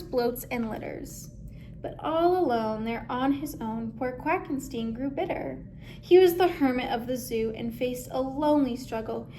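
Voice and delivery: ordinary delivery, nothing marked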